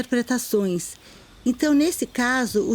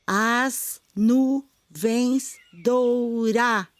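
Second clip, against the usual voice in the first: "As nuvens doura-" split into slow, separate syllables; counting syllables; much slower than usual. Shown as a demonstration. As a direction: tap out each syllable of "doura-" slowly. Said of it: "E o das seria uma sílaba átona, a gente não conta".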